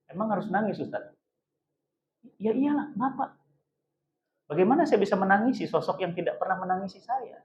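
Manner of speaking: very soft, restrained answer; subdued child's reply. Acted. Grieving child tells ustaz why there are no tears, subdued. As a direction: answer the preacher quietly, holding back.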